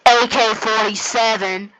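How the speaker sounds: slow, announcer-like reveal; mock-epic weapon announcement; dramatic reveal. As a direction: draw the name out like a big reveal.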